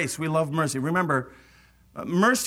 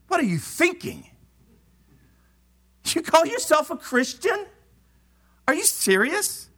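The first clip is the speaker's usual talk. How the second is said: high, scornful mock scold; mocking judgment; 12 semitones higher than usual. Churchgoer scolding a fallen brother, high scornful mockery. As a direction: sneer it like a judgy churchgoer. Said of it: "so often it's".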